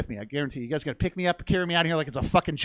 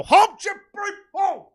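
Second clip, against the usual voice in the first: very high, loud call to a horse; hammed-up calling out, pitched way up; mock-grand command; 13 semitones higher, 6 dB louder, slower than usual. Rider respectfully commanding his horse, mock-grand call. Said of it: "Be respectful. Use his name".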